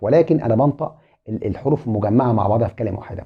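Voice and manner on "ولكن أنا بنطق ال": ordinary delivery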